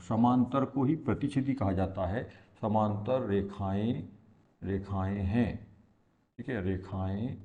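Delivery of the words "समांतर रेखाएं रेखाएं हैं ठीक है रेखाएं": slow, low words parcelled out with pauses; drawn-out writing-along voice; slow dictation. Real, not acted. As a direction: murmur along while writing it down. Dictating slowly while writing on board.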